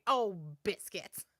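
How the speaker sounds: slow, put-on cartoon kid voice; Bluey voice. Daughter caught out, doing Bluey's slow cartoon grumble. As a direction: grumble it like a cartoon puppy who got caught. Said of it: "slam your fist like Bluey and say".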